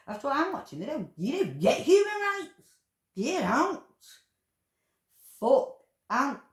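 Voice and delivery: high, loud raging shout; furious yelling. Real, not acted. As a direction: let the outrage burst out. Raging about the abattoir workers.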